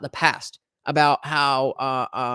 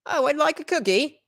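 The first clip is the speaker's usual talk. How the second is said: high-pitched mock English accent; 10 semitones higher than usual. Shown as a demonstration. Guest shows how England rubbed off on them, mock English accent. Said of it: "uh, an English accent".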